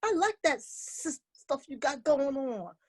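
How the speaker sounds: high, quick-starting friendly protest; encouraging cricket. Acted. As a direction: pipe up like a supportive little cricket. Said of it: "And Cricket said".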